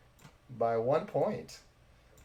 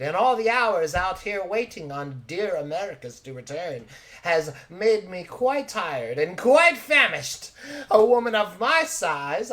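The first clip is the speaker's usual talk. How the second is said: theatrical lady's speech, building louder and higher; Thelma, grandly famished; 4 semitones higher, 5 dB louder than usual. Acted. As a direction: declaim your hunger dramatically, stretching key words. Thelma invites party to lunch.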